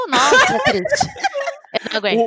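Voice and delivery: squeals it out laughing; laughing complaint. Real, not acted. Friend mocking sex small talk, laughing it out. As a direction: laugh through the words. Laughing at her own story.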